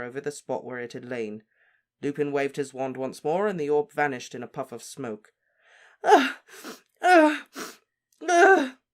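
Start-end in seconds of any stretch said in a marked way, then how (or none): 6.0-8.8 loud, very high gulping gasps; mother gulps for air, loud and high, gulping sobs. Acted. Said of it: "gulped Missus Weasley".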